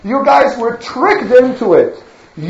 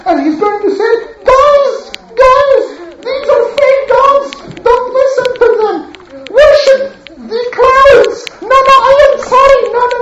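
much higher, silly mimicking of a preacher; mocking imitation; 13 semitones higher than usual. Townspeople mocking Noah, high silly parody. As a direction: parody Noah's warning with a laugh. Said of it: "they mock him like this and say".

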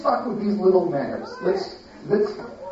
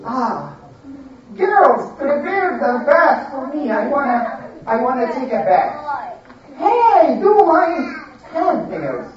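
high, bossy pampered voice; spoiled lady; 6 semitones higher than usual. Acted. Lady demanding bath and perfume from her maids, high and bossy. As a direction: bark orders like a lazy rich lady.

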